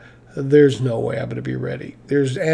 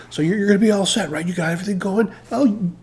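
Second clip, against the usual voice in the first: mock boss check-in; 7 semitones higher than usual. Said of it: "lie right to".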